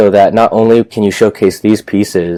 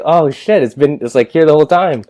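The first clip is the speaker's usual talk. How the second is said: high-pitched, amazed realization; retold astonishment; 7 semitones higher than usual. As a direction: act out the sudden discovery, pitch jumping up. Retold realization the space was there.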